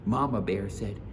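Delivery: plain reading voice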